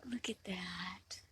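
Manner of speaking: hushed whisper so as not to scare it. Real, not acted. Whispering to viewers while filming a tiny bug. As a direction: breathe it out, barely voiced.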